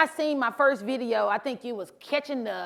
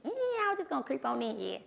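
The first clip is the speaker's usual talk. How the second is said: sneaky creeping in; 2 semitones higher, 8 dB quieter, faster than usual. Retold or imitated. Sneaking into a quiet store.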